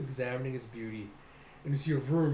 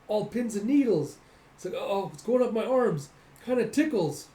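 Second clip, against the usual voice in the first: high, louder, wide-eyed reaction; mock wonderment; 7 semitones higher, 5 dB louder than usual. Boy as vibration climbs his arms, high-pitched amazement. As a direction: act startled by a strange tingle.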